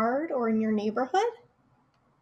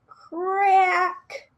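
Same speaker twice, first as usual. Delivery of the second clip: cracking egg sound; 6 semitones higher, 4 dB louder than usual. Acted.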